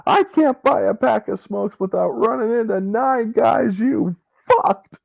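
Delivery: high mock rant. Quoting the movie rant.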